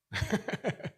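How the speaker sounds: high burst of laughter; laughing. Real, not acted. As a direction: laugh out at your own joke. Congregation joke laugh.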